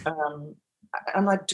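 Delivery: ordinary delivery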